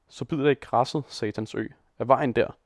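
usual speaking voice

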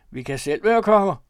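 high, forceful grunted boast. A narrator's reading. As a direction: grunt it out boisterously. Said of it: "grøntede Jarum".

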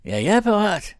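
very high, loud challenge; shouting. Acted. Thomas challenging Paul to fight, shouting. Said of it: "råbte Thomas".

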